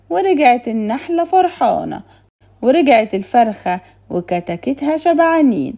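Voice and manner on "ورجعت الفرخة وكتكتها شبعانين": ordinary delivery, nothing marked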